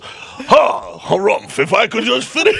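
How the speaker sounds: posh harrumphing transatlantic voice; transatlantic accent. Retold or imitated. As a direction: play a stuffy transatlantic gentleman. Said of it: "transatlantic accent".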